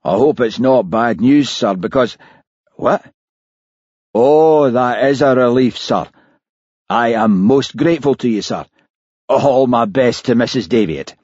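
ordinary delivery

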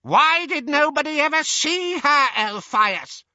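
put-on very high, loud old-woman squawk; piercing, squawked question. A narrator's reading. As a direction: squawk the challenge at him. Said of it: "squawked Muriel".

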